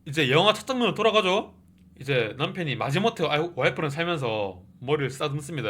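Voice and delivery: ordinary delivery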